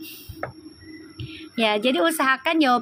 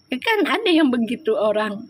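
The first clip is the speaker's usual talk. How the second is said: ordinary delivery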